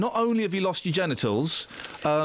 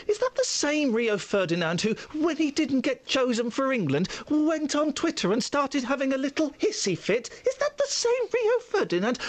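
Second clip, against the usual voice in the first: mock whining; 6 semitones higher than usual. Mocking footballer's crying and hissy fit.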